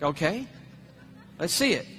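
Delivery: low, soft, half-hearted agreement; acted lukewarm reply; underwhelmed. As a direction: go along with little interest.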